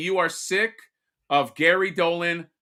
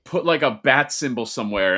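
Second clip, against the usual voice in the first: normal talking voice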